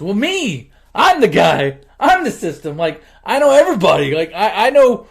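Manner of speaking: worked-up retold boast; emphatic insisting. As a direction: insist loudly, acting out his eagerness. Rocco insists he's the system.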